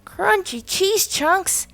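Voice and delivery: high, slow, drawn-out cry of joy; excited shout. A narrator's reading. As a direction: savor the surprise. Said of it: "shouted Trap".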